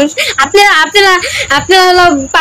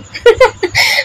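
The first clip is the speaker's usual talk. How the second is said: short laugh; 6 semitones higher than usual. Real, not acted. Laughing at a comment.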